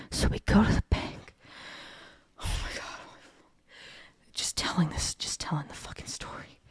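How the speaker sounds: weary, breathy delivery with little voice; tired, voice giving out; worn out. Real, not acted. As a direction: sigh it out, drained by the telling. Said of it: "I'm, like, tired thinking about it".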